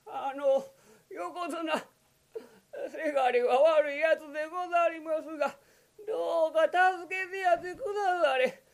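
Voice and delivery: put-on high tearful old mother voice; old woman pleading. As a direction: beg for your son's life. Old mother begs for son. (涙声で息子の命乞いをする老母)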